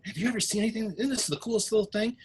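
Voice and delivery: high, coaxing in-character voice; friendly visitor. Acted. Visitor shows girl a little horse, coaxing. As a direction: pitch it up to show off a toy.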